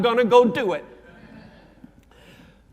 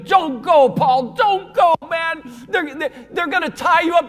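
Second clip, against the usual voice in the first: pleading; 6 semitones higher than usual. Retold or imitated. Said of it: "Christians everywhere were pleading with him".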